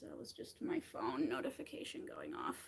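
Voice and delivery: a hushed aside, almost no voice; whisper. Real, not acted. Whispering to viewers about her phone going off.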